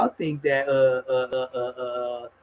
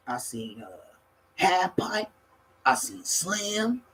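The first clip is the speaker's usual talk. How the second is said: ordinary delivery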